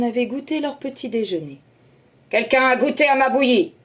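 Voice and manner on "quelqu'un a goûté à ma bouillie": loud booming Papa Bear; Papa Bear to his family, loud and big; big gruff voice. Acted. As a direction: bellow like a huge bear. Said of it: "d'une très grosse voix".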